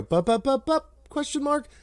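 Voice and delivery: playful rising bups; demo rising question. Shown as a demonstration. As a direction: sing the bups up like a question mark. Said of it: "the question goes up at the end".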